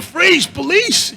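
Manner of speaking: high, very slow drawn-out shout; cop shouts. Retold or imitated. Cop in the Arby's calling out, slow high shout. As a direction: stretch the command out. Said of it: "I hear".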